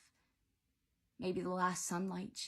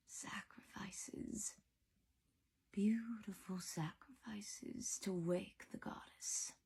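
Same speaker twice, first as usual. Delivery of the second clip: slow, drawn-out villain's whisper; eerie whisper; 3 semitones higher, 8 dB quieter, slower than usual. A narrator's reading. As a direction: whisper it like a voice from the darkness. Eerie voice speaks from the darkness.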